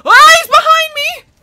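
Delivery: sudden terrified yelp; startled scream. Real, not acted. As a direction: scream in real fright as he appears. Zombie boss suddenly behind her.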